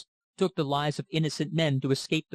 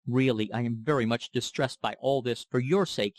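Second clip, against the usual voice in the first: usual speaking voice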